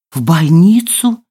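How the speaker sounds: slow, stretched question; lingering, rising query; drawn-out. A narrator's reading. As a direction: draw the words out in doubt. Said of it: "протянула женщина".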